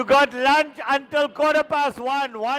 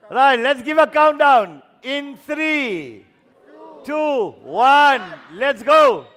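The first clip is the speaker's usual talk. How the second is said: calling out; 3 semitones lower, 4 dB louder than usual. Real, not acted. Leading a countdown for the singers.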